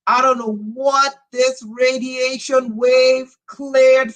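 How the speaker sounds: excited yelling. Real, not acted. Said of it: "Sorry, I'm not yelling".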